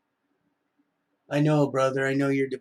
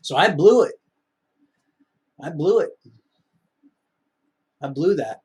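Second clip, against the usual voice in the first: loud self-reproach; 3 semitones higher, 8 dB louder than usual. Real, not acted.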